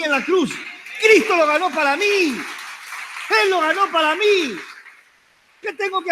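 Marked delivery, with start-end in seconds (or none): 2.0-4.6 slow, drawn out. Acted. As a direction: stretch every word slowly. Repeats phrase, second drawn out. (despacio, alargando cada palabra)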